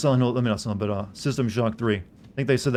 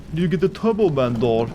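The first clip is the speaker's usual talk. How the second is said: Arnold quote; 4 semitones higher, 3 dB louder than usual. Pretend. Quoting Jingle All the Way Arnold.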